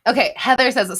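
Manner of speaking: ordinary talk, nothing marked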